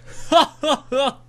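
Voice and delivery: loud high laugh falling away; subsiding laugh. Acted. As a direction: let the laughter trail off. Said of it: "When his laughing had somewhat subsided".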